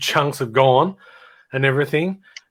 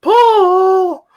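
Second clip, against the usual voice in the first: long, loud falsetto holler; stretched-out high-pitched shout; mock yell; 7 dB louder, much slower than usual. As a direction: imitate a crowd heckling in mum's high voice. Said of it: "yell out at the top of their voice".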